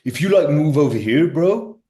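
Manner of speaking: high loud imitation of Loki's accent, bro; teasing a mate with Loki's accent. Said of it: "I'll do this accent".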